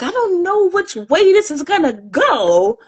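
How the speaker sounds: high, uncertain voice for a worried thought; anxious inner thought. Retold or imitated. To herself about the kid, a high, worried mock thought. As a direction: voice the nerves up high. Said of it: "I'm like".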